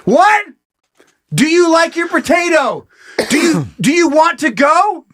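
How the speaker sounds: high-pitched yelled small talk; mock shouting over noise. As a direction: struggle to be heard over a rave. Shouting to date over rave.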